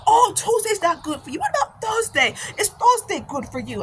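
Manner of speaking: high, eager imitated African accent; African-accented pushy scheduling; African brother's accent. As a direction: mimic a persistent African brother. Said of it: "this brother from Africa".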